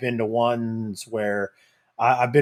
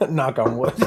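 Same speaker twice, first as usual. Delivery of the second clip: laughing; 4 semitones higher, 5 dB louder than usual. Real, not acted. Laughing superstitious aside.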